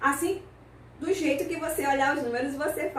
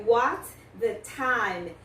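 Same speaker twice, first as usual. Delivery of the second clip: slow British model; much slower than usual. Shown as a demonstration. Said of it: "Tem uma outra pergunta".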